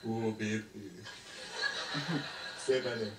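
low, hammed-up bedroom voice; mock lover's voice. Man to his woman making love, hammed-up and low. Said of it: "Imagine he's in there with his woman".

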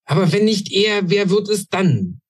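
stamping impatience. A narrator's reading. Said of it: "Krakel stampfte auf".

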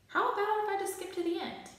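eager, high-pitched plea; high pleading voice. A narrator's reading. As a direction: voice jumps way up, hopeful.

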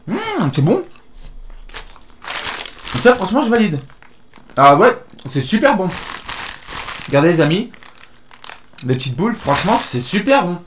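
talking around candy; chewing while talking. Real, not acted. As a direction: mouth partly full. Seen 1: hand to mouth at -2.0, then mouth closed, chewing-like.

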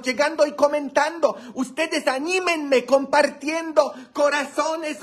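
high-pitched, sustained rallying pitch; rally the audience in a high, excited voice; hype-man appeal. Acted. Host urging viewers to share and like, high-pitched hype.

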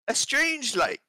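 high, slow British narration; British accent. Acted. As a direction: read it like an English prince. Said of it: "I'm gonna do this in his voice".

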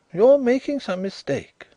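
high, wary denial; guarded. A narrator's reading. Visitor denies to Carrados, guarded and high. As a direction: hold it back, guarded. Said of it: "said Drishna guardedly".

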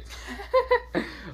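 high cackling laugh; maniac giggle. Acted. Deku interrupting after being told to stop, maniac laugh. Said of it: "laughing like a maniac".

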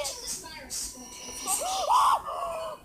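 high frightened gasp; sharp gasp. Real, not acted. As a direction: gasp in sudden fear. Sees monster.

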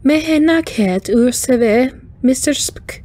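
high, slow formal salutation; high wind chime voice, measured; chiming alien greeting. A narrator's reading. Alien greeting the Vulcan first officer, high and chiming.

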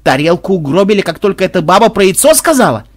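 high, loud, indignant questioning; offended roar. A narrator's reading. Makhmud protesting about the broken plate, in an offended roar. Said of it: "обиженно взревел Махмуд".

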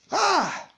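sharp high "Ah!"; short, high pulpit exclamation; preacher's exclamation. Real, not acted. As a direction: let out a sudden high cry. Preacher's exclamation after refrain.

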